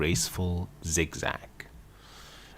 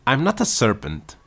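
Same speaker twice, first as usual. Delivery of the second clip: higher and louder, offended; indignant; 7 semitones higher, 7 dB louder than usual. A narrator's reading. Alice protesting to the pigeon, indignant. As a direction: protest sharply, insulted. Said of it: "said Alice indigently".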